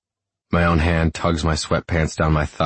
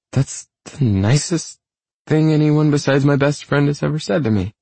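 high, wobbly and touched; woman close to tears with gratitude; 11 semitones higher than usual. A narrator's reading. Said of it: "her voice wobbly".

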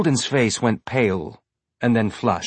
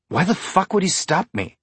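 higher, clipped angry question; biting the words; 8 semitones higher than usual. A narrator's reading. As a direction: bite each word off in anger. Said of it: "biting the words".

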